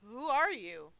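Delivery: loud, wavering nervous question; nervous schoolboy. Acted. Frightened hero questioning the monster, nervous schoolboy. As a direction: ask like a schoolboy facing his teacher. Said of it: "My voice trembled. I spoke like a nervous schoolboy".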